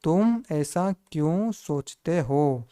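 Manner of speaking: slow, word-by-word sentence; slow read-out. Shown as a demonstration. Teacher reading the finished sentence to students, slow read-out. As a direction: draw out each word for learners. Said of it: "तो क्या हो जाएगा दोस्तों?".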